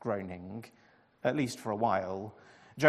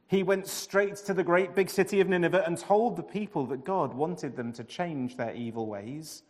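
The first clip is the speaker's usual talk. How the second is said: ordinary delivery, nothing marked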